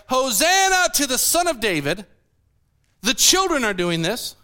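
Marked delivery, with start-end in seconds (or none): 0.1-2.1 raised, higher mock cheer; mild mock shout. Children praising in the temple, a mild raised cheer. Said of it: "children shouting in the temple area".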